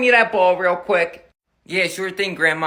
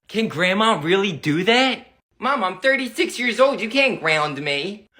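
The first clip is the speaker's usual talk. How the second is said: usual speaking voice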